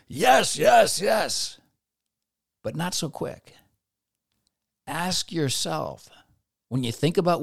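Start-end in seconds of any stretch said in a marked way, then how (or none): 0.1-1.6 eager high loud yeses; mock-eager emphatic yes. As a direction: jump at it, yes yes yes.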